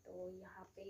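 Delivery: whispered aside. Real, not acted. Whispering to viewers so the sleeping baby stays asleep. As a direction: breathe it out under the breath.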